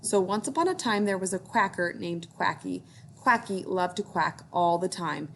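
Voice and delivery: ordinary delivery, nothing marked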